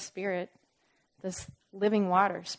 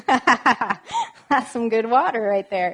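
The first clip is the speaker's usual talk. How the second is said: louder, slow, laughing through it; 3 semitones higher, 9 dB louder, much slower than usual. Real, not acted. Preacher relishing the verse aloud, laughing. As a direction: chuckle with delight over the verse.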